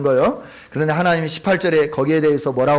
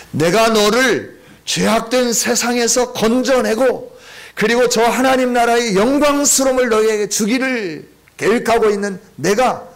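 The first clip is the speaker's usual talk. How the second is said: full-force preaching; 6 semitones higher, 3 dB louder than usual. Acted. Pastor proclaiming God's promise to the congregation, fervent preaching.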